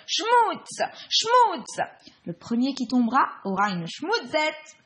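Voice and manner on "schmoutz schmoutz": high gleeful shout. Acted. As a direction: shout it out with a big smile. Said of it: "souriez et criez".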